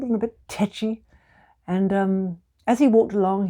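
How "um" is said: ordinary delivery, nothing marked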